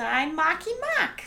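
a hint of [name], pitch pushed up; [name] impression. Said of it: "His voice just always goes up".

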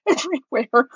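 ordinary delivery

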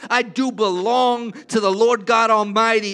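loud, swelling preacher's proclamation; preaching at full force. Acted. Pastor declaring God's faithfulness to the congregation, loud and fervent. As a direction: lift the room with bold declarations.

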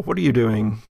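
ordinary delivery

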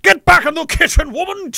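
high, put-on period-gentleman bellow; hammed-up old-time domineering lord; eighteen-hundreds husband. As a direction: bark it like a mock Victorian husband. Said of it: "That's the time period I was in".

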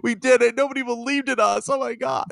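mock crying. Podcaster mocking Astros as if they were crying, mock crying. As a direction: imitate tearful players in a hammed-up high voice. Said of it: "if the Houston Astros were crying".

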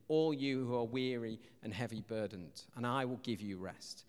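low, soft reassurance; gentle invitation. Acted. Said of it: "Jesus says".

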